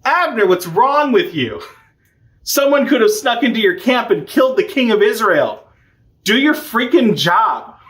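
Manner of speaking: high-pitched retold telling-off; mock yelled scolding. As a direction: scold from afar, not truly shouting. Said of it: "he yells down".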